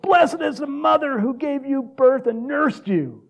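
high, drawn-out call from a crowd; woman's cheer from the crowd. Acted. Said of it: "a woman in a crowd called out".

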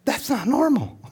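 high, lilting mock Scottish quip; a lightly hammed-up Scottish accent. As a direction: pipe up like a Scottish theatregoer. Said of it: "pop up and go".

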